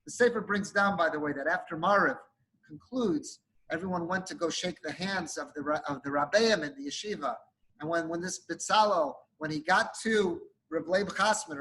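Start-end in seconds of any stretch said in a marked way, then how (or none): none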